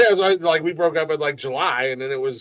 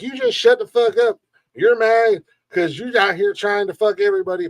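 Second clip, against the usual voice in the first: raised, louder berating voice; mock drunken tirade; 5 semitones higher, 4 dB louder than usual. Drunk frat boy berating a woman in the street, mock angry. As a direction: imitate a drunk frat boy telling someone off.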